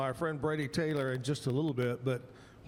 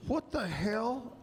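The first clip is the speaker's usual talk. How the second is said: slow, higher-pitched outraged quote; drawn-out, affronted demand; mock-serious indignation; 6 semitones higher, slower than usual. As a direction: imitate a singer stopping the show in disbelief. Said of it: "he's being real serious".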